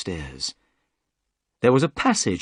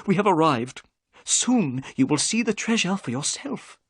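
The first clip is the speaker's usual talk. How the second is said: a touch of excitement, higher; mildly excited storyteller; 12 semitones higher than usual. A narrator's reading. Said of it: "Thaddeus Sholto's voice became excited".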